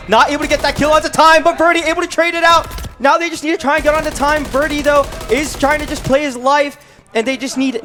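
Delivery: rising excitement, high and loud; excited play-by-play. Real, not acted. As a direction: call the clutch moment with hype. Live play-by-play, time running out.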